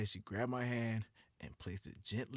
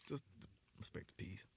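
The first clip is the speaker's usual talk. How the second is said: whispered; 5 semitones lower, 15 dB quieter than usual. Real, not acted. Hushed aside about ex.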